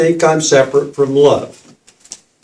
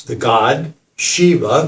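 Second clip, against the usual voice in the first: ordinary delivery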